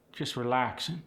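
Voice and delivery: usual speaking voice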